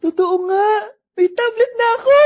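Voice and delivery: loud, high thrilled cry; overjoyed shout. Acted. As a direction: shout it out in pure joy. Child waking, thrilled for tablet. (sumisigaw sa sobrang tuwa)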